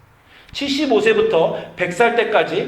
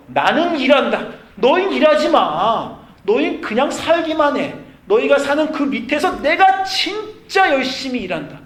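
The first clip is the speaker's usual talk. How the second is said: raised, higher voice with forceful conviction; emphatic divine declaration; 8 semitones higher, 6 dB louder than usual. Acted. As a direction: proclaim God's promise insistently. God's emphatic promise, voiced by preacher.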